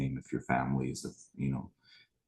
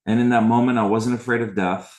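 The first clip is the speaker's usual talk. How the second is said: intense; 7 semitones higher, 9 dB louder than usual. Real, not acted. Climax of story.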